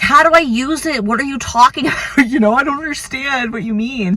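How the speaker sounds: high, baffled, laughing protest; imitating her own confused outburst; exasperated. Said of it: "I'm like".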